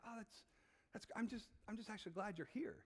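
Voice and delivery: soft, hesitant reassurance; gentle fatherly reply. Acted. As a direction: let them down softly. Father gently answers busy child.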